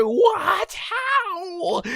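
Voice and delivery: very high, loud cry of disbelief; exaggerated shriek of shock; astonished yell. Fighter whose swing was dodged, shocked yelling.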